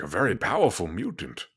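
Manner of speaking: lightly voiced Patrick Stewart transatlantic; a hint of Professor X gravitas; Patrick Stewart impression. Host imitating Professor X's transatlantic accent. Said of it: "He's like".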